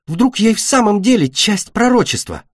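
high, excited wondering; eager hope. Acted. Беда hopes she is chosen. (взволнованно, с надеждой, высоким голосом)

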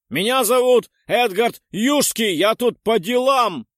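loud, slow, high shout. Acted. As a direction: shout it into a deaf ear. Shouting into deaf ear.

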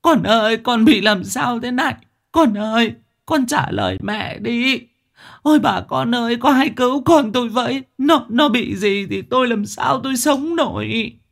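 high, loud, anguished wailing; mother wailing over her dead son. A narrator's reading. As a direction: wail and beg neighbours for help. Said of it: "bà gào lên".

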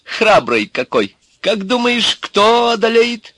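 очень высоким, протяжным женским голоском; восхищаться напоказ, забираясь всё выше; льстиво. A narrator's reading. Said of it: "льстиво воскликнула она". (заискивающе, тоненько)

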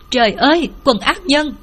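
mildly acted horror; horrified cry. A narrator's reading. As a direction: cry out in shock, not full volume. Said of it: "Nghe con gái kêu thất thanh".

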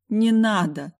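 tearful plea; pleading. Acted. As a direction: beg through tears. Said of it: "молила я".

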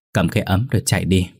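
plain reading voice

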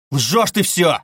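loud, high-pitched fury; unrestrained yell. A narrator's reading. Suspect losing control at investigator, furious yell. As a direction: explode at the liar. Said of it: "завопил Раскольников, уже не удерживаясь".

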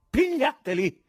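high, loud frantic call; frantic high-pitched shout; frenzied yell. A narrator's reading. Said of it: "urlò frenetico il presidente".

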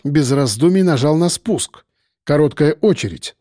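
usual speaking voice

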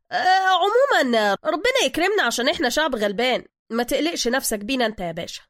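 high, loud opening that eases off; chatty driver covering up. Acted. Driver backtracks to his passenger, loudly reassuring. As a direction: cover up with noisy folksy reassurance.

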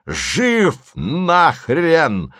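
высокий, протяжный крик; крикни, больше не сдерживаясь; несдержанный крик. A narrator's reading. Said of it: "закричал Голубович, более не сдерживаясь". (растянутый вопль без удержу)

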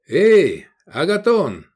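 high, drawn-out hail; shouted call. A narrator's reading. Customer hailing the tavern keeper, shouted call. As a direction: call out loudly for the innkeeper. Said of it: "крикнул Искандер".

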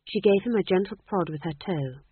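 usual speaking voice

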